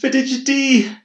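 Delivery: high, loud furious scream. A narrator's reading. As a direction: scream it at him in horror. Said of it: "Claire screamed at Ryan".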